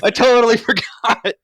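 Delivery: loud, high, laughing through it; retold shock, laughing; laughing disbelief. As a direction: crack up while exclaiming. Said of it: "And I'm like".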